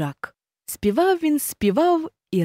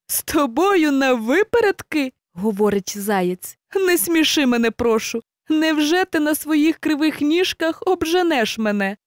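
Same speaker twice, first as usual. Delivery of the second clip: higher, amused hare voice; scoffing hare; 7 semitones higher than usual. Acted. Hare scoffs at the race offer, mocking. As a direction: laugh off the challenge.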